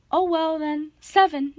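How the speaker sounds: high, pleading bride voice; falsetto. A narrator's reading. Grover bargains with the monster for time, falsetto. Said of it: "Grover said in his falsetto voice".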